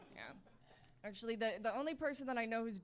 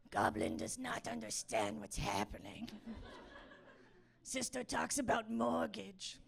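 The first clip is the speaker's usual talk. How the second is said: goblin voice. Acted. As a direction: talk like a baffled little goblin in broken sentences. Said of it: "the goblin that's running on a treadmill in my head".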